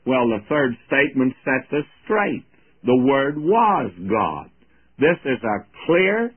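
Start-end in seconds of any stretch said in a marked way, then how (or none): 2.8-4.5 slow, weighty "The Word was God"; slow declaration, stress on "was"; emphatic. Shown as a demonstration. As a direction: plant each word, slowly. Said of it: "emphatic declaration".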